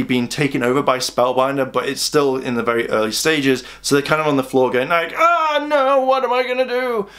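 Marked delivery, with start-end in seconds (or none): 5.1-7.1 much higher, hammed-up panicked wail; mock panic. Rangers on the floor under the spell, hammed-up panicked wail. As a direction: ham up a helpless cry.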